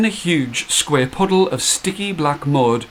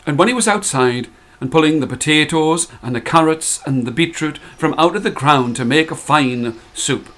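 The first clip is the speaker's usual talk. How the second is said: usual speaking voice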